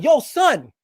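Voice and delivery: loud, high New York "yo, son"; brash New York friend calling nonsense; mock New York accent, loud and high. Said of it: "I don't wanna do his New York accent".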